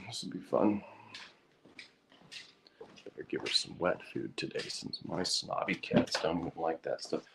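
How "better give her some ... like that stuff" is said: muttered aside. Real, not acted. Muttering to self.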